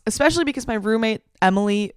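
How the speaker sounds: normal talking voice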